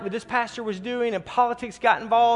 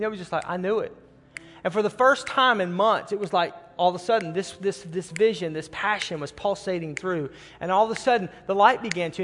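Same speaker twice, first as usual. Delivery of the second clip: normal talking voice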